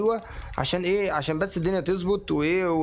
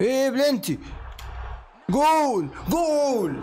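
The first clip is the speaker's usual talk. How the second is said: goal yell; 8 semitones higher, much slower than usual. Real, not acted. Gamer celebrating a penalty goal, an ecstatic yell. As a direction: burst out as the penalty goes in.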